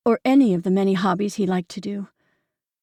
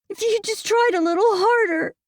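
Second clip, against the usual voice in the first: put-on teen girl voice; 14 semitones higher than usual. A narrator's reading. Daughter voiced, pleading.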